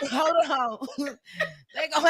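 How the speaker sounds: talking through helpless laughter; can't say it for laughing. Real, not acted. Host trying to tell the disclaimer joke, laughing too hard to say it. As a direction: fight the giggles to get the words out. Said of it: "I can't even say it".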